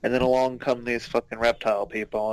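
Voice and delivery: ordinary delivery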